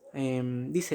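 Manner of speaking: ordinary talk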